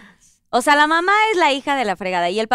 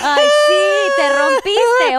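emotional cry; 11 semitones higher, 5 dB louder, much slower than usual. Real, not acted. Moved reaction to son's message.

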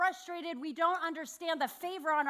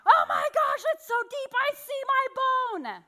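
put-on higher, louder horrified kid voice; panicked son; 11 semitones higher, 7 dB louder than usual. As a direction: lightly play a shocked boy seeing his bone. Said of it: "he was like that".

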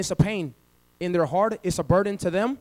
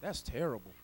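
ordinary talk

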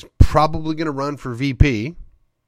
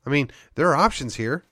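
ordinary delivery

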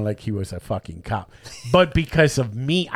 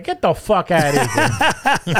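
laughing scoff; 4 semitones higher, 3 dB louder, slower than usual. Retold or imitated.

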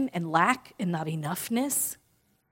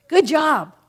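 higher, louder cheer; inner cheerleader; 7 semitones higher, 8 dB louder than usual. Pretend. Speaker voicing the inner cheerleader, higher and loud. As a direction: cheer it out bright and big. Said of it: "what does the inner cheerleader say".

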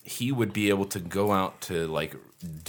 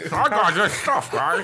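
goofy high voice; 11 semitones higher, 4 dB louder, slower than usual. Pretend. Put-on high voice.

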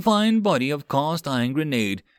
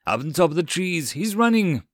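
raised, urgent shout rising higher; calling out; 7 semitones higher than usual. A narrator's reading. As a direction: alert the squad to a fleeing enemy. Marines calling out a sighting.

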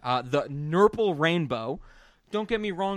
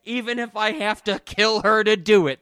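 high, loud overacted cop; cop's promise; 6 semitones higher, 8 dB louder than usual. Host quoting the cop to his cohost, loud and high. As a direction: belt it out like a TV cop. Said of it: "That's what the cop says".

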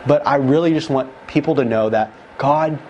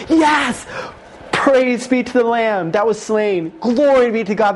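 much higher shouted praise, then slower; retold angel shouting; 7 semitones higher, slower than usual. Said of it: "they were screaming on top of their lungs".

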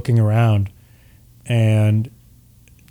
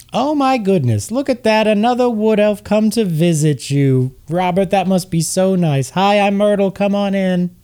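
chirpy Myrtle; 7 semitones higher than usual. Acted. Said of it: "you hear a voice go".

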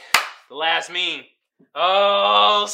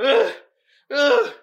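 ordinary talk

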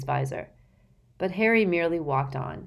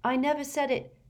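high, sharp denial; annoyed; 10 semitones higher than usual. A narrator's reading. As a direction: snap it back in protest. Harry snaps, annoyed.

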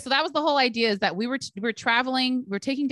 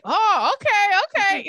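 high, loud, slow drawn-out oh okay; taken aback; 11 semitones higher, 8 dB louder, slower than usual. Retold or imitated. Stranger hears friends trash each other, drawn-out surprised okay. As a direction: stretch out the surprise, caught off guard. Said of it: "And I was like".

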